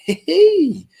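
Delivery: high, drawn-out mock squeal of glee; loud, high-pitched "hee hee", far above normal voice; gleeful hee-hee. Fan privately celebrating being let through, gleeful squeal.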